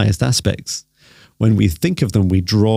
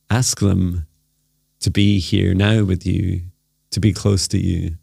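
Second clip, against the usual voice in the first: guided meditation. Acted. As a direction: gently lead them into stillness.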